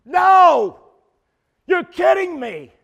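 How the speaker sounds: high, loud shocked cry; loud incredulous outburst; shocked disbelief. Retold or imitated. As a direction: act out stunned disbelief. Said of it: "I'm shocked and I'm like".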